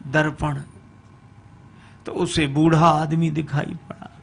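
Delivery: usual speaking voice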